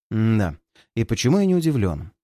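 plain reading voice

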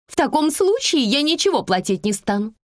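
high, offended woman's voice; indignant refusal. A narrator's reading. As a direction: refuse huffily to pay. Ejected passenger refuses to pay.

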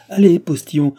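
high, loud command; shouted order. A narrator's reading. Man sends the postillion on, shouted order. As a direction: call out to the driver.

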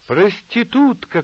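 high, loud, drawn-out yell; shouted insult. A narrator's reading. Nina hurling an insult at Asya, shouting. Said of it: "кричала она".

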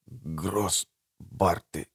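slow, faint, halting whisper; broken whisper. A narrator's reading. Dying Bertram recognises the Grossbart brothers, broken whisper. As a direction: push each word out weakly with a long break. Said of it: "прошептал он разбитыми губами".

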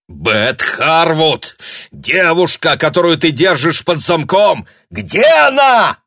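высокий яростный крик; требует ответа, срываясь на крик; angry shout. A narrator's reading. Душит дядю, требуя ответа. (яростный крик)

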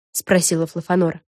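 ordinary delivery, nothing marked

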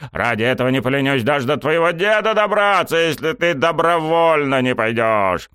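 high-pitched, heated insistence; insistent vow. A narrator's reading. Coach threatening to reach grandfather, heated insistence. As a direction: press the threat with rising fervour. Coach vowing to draft student.

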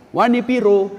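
a laughing take on the TV ad line; TV ad imitation. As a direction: quote the commercial with a grin. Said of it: "seperti itu iklan yang di televisi". (meniru iklan televisi sambil tertawa)

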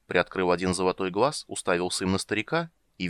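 usual speaking voice

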